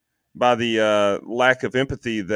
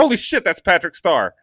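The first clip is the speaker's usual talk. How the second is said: higher-pitched excited realization; retold amazement; 6 semitones higher than usual. Telling girlfriend who the actor is, amazed. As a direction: blurt out a sudden recognition.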